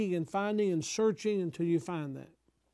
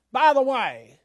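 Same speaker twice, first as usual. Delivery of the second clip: higher, loud quoted aside; punchy, raised-voice interjection in imitation; imitated loud interjection; 6 semitones higher, 9 dB louder than usual. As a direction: call it out suddenly, quoting a preacher. Quoting preacher's sudden aside.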